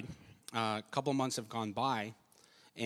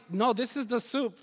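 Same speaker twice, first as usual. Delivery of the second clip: much higher, firm No; husband insists to his wife; insisting; 10 semitones higher than usual. Retold or imitated. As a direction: stand by the dish. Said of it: "And I'm like".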